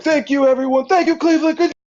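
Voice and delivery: high, loud stage shout; calling to crowd. Acted. Band thanking the crowd from stage.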